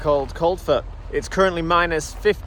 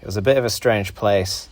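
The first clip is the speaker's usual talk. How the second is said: lower, steady documentary-style narration; travel voiceover narration; 7 semitones lower than usual. A narrator's reading.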